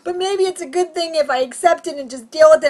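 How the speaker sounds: high, loud mock-crying reasoning; tearful self-consoling. Comic talking herself round, tearful mock wail. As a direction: keep wailing while easing slightly down. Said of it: "I am literally convulsing in tears".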